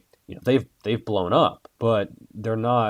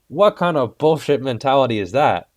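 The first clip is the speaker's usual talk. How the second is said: higher, louder outraged question; worked up; 5 semitones higher, 6 dB louder than usual. Real, not acted. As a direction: let the indignation come through. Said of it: "I'm getting worked up right now".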